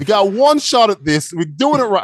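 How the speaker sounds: high, insistent Aussie director; slow, emphatic bad Australian accent. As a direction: lay down the law in put-on Australian. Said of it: "I'm an Australian here".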